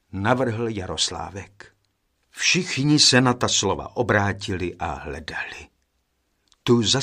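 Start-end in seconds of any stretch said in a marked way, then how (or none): none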